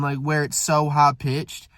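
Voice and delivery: ordinary delivery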